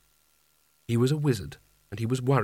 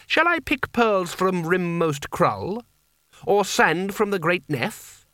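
higher, slow, challenging questions; metallic edge; 6 semitones higher, slower than usual. A narrator's reading. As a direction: draw out each taunting offer, pitched high. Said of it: "his voice took on a metallic edge".

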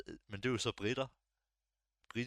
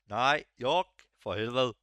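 louder, higher outburst hitting "York"; exasperated correction; 3 semitones higher, 10 dB louder, slower than usual. Retold or imitated. Retelling being misheard about the city, mock exasperated. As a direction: correct them as if fed up with repeating it. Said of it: "i starten, der er sådan".

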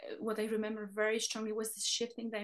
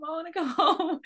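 put-on higher, louder childlike whine melting into a laugh; repeating the homesick plea while laughing; 6 semitones higher, 7 dB louder than usual. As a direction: whine it again but giggle through it.

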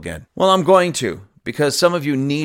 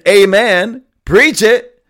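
raised, louder amen cry; mock congregation shout; 6 semitones higher, 8 dB louder, slower than usual. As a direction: shout approval like a churchgoer. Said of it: "Stop shouting".

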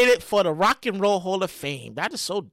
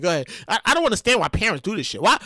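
ordinary talk

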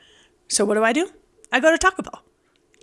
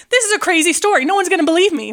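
excited; 6 semitones higher, 3 dB louder, faster than usual. Retold or imitated.

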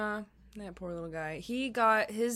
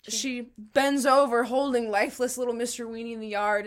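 ordinary delivery

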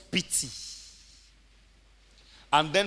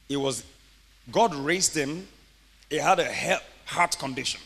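ordinary delivery

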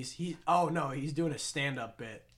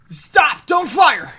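high, loud panicked yell; shout; 13 semitones higher, 18 dB louder, much slower than usual. Acted. Yelling at his teammate not to fire. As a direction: yell at them to hold fire.

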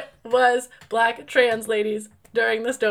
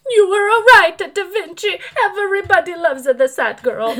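louder, higher fake sobbing; mock weeping mama; 5 semitones higher, 5 dB louder than usual. Grieving mama at Da Vinci's grave, mock weeping. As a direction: wail it over a grave, hammed-up. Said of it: "Mama weeping over his grave going".

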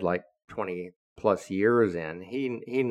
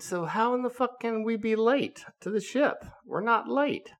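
higher, mock-indignant protest; indignant outburst; 13 semitones higher than usual. Young officer protesting to shipmates, indignant. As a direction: bristle at the accusation, pitched up. Angry sailor protesting lateness.

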